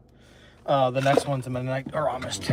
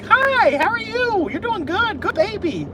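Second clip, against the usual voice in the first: very high, loud cooing to a dog; super-happy pet voice way up high; high-pitched dog talk; 8 dB louder, slower than usual. Shown as a demonstration. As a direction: greet a dog in an exaggerated baby-talk squeal. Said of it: "talk to a dog in a high-pitched voice".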